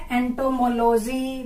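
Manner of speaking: एंटोमोलॉजी as ento-mo-LOH-jee; mispronounced एंटोमोलॉजी. Shown as a demonstration. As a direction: keep लॉजी a separate unit in एंटोमोलॉजी. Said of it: "मैं शुरू से यही पढ़ती". (एंटोमोलॉजी को एंटोमो-लोजी तोड़कर)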